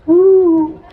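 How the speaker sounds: high, loud "woo"; loud celebratory whoo; whooping cheer. Real, not acted. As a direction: let out a sustained high whoop. Cheers at mention of Kentucky.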